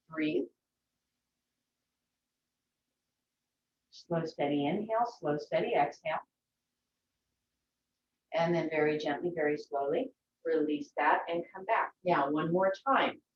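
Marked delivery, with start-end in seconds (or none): 0.1-11.9 softer, calm breathing cues; soft guided relaxation voice. Acted. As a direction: ease them down gently, breath by breath.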